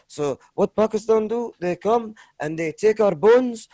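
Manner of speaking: put-on Afghan accent, earnest explaining. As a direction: do the Afghan driver, word by careful word. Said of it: "trying my best to do his accent".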